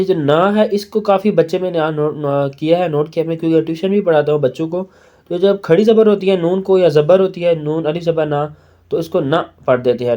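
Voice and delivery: usual speaking voice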